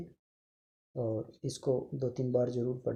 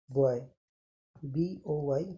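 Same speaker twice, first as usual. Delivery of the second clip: ordinary talk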